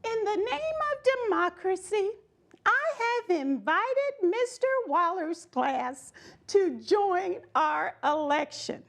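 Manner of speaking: a teacher proclaiming to the class; a lightly voiced teacher's announcement; announcing teacher. A narrator's reading. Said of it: "made an announcement".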